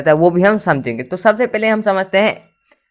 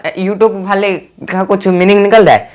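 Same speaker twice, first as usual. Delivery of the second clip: ordinary talk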